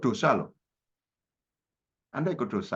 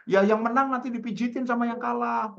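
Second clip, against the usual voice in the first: high-pitched playful bargaining; imitated childhood chatter; 10 semitones higher than usual. Boy sets the bet before football, high playful imitation. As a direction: play a child setting game rules. Acted childhood game deal.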